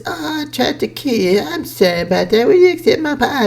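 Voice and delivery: high mocking impression of the old samurai woman saying sorry; mocking her apology. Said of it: "And she goes".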